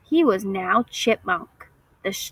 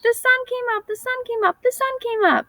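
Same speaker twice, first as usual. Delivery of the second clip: high, bright chirping squirrel; chirpy squirrel. A narrator's reading. Happy squirrel chirping about the sunrise, high and gleeful. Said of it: "he chirped".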